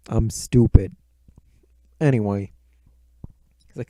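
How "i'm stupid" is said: ordinary talk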